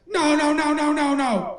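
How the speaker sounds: high, rapid-fire string of refusals; hammed-up desperate objection; frantic protest. Acts coaches protesting the decision.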